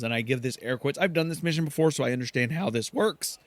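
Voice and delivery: ordinary delivery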